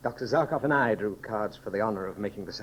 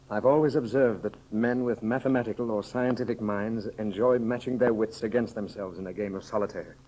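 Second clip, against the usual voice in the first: ordinary talk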